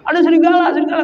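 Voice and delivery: high-pitched wolf alarm; mock alarmed shout. Herder fools villagers with "ada serigala", mock alarmed shout. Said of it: "Baru teriak lagi tuh".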